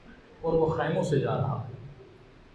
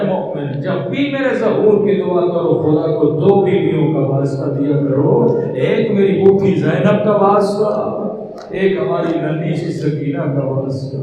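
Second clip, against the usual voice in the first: mournful majlis chant, louder; loud lament recitation; 7 dB louder than usual. Acted. Preacher recounting Sakina's question to the crowd, loud mournful lament. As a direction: wail the tale out to the mourners.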